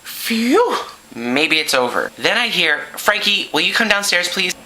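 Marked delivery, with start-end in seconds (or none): 0.0-0.8 high, blown-out sigh of relief; hammed-up whew of relief; relieved phew. Kid thinking trouble is over, relieved phew. 3.0-4.6 higher, singled-out summons; mom calling out. Acted. Mom summons son downstairs, calling out. Mother calls kid downstairs.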